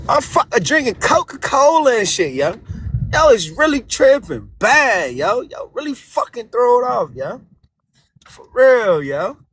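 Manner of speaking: heavy Baltimore slang, hard to follow; thick Baltimore accent. Acted. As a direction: talk like you're from Baltimore, thick. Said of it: "Voice just kicked in".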